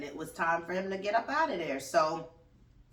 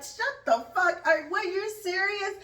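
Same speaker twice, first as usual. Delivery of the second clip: much higher, louder disbelieving yell; incredulous outburst; 14 semitones higher, 4 dB louder than usual. Retold or imitated. Host reacting to her guest's answer, incredulous yell. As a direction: blurt it out in shock. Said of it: "I was like".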